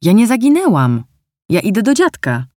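put-on girl insists she isn't lost; child protesting. A narrator's reading. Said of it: "zaprotestowała Ania". (wyższy głos protestującej dziewczynki)

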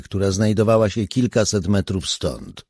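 ordinary delivery, nothing marked

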